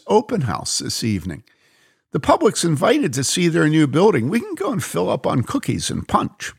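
Mary's bright voice. Acted. Mary tells the Israeli about the free open house, eager. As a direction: voice Mary, keen to get free food.

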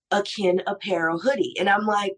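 normal talking voice

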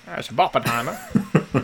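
put-on slow old-time radio announcer; 1950s radio voice. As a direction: say it like a fifties radio host. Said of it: "nineteen fifties radio voice there".

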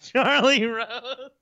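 high, slow, drawn-out disbelief; incredulous echo. Acted. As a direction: echo the name high and stretched, aghast. Echoes name in mock disbelief.

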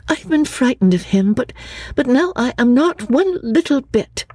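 put-on high, earnest young woman's voice; young Lucy. Lucy answering her cousin Charlotte, earnest and high.

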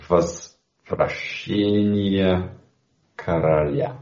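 slow careful Russian, Возвращение короля spelled out syllable by syllable. Shown as a demonstration. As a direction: sound out Возвращение короля slowly, word by word. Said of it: "in Russian".

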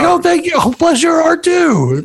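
very high, gushing mock gratitude; passive-aggressive Southern charm. Naive target returning a "bless your heart", in exaggerated sugary charm. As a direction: gush it back high, with hammed-up Southern sweetness. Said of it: "passive aggressive Southern charm".

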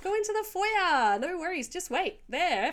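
high, playful usher voice; put-on hospitable receptionist; mock host welcome. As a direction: usher pretend guests in, pitched right up. Ushering imaginary guests into foyer.

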